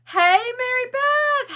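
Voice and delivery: high, loud, drawn-out hey; called-out greeting. Real, not acted. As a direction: call out brightly to a friend arriving. Calls out to a joining viewer.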